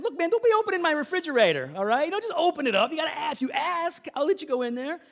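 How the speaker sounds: loud, high, exasperated telling-off; indignant scolding. Retold or imitated. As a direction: re-enact laying down the law to a kid. Retold scolding over opened refrigerator.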